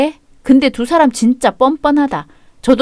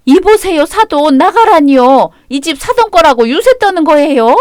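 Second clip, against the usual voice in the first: higher and louder, offended protest; indignant outburst; 5 semitones higher, 3 dB louder than usual. Acted. Mother confronting her son's father-in-law, outraged and louder. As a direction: push back hard at the in-law, outraged.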